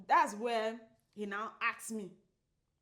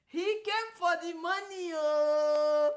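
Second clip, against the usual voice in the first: high, loud, drawn-out final "oh"; teasing exclamation; 7 semitones higher, 9 dB louder, slower than usual. Real, not acted. Woman teases the man about money, gleeful jeer. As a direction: crow it out teasingly, stretching the end.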